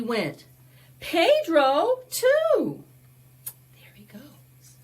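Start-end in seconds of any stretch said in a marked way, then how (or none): 3.7-4.8 soft whisper to the little listeners; whispered aside. Real, not acted. Storyteller whispering to the kids as they sled, hushed. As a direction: hush it like sharing a secret. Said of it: "aside to children".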